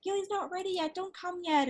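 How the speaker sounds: mock-panicked groomer on the phone; flustered groomer voice. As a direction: play the harried groomer holding her off. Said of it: "She's like".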